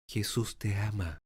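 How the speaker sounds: soft, low tender words; hushed Jesús te ama. Shown as a demonstration. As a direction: say it gently, almost hushed. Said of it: "Es más suave".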